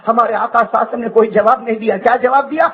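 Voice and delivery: usual speaking voice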